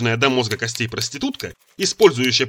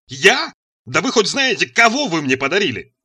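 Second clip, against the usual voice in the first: higher, louder outraged question; indignant yell; 6 semitones higher, 4 dB louder than usual. A narrator's reading. Son protests to his mother about her gift, yelling. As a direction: throw the blame back, voice raised. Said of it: "неожиданно заорал я на матушку".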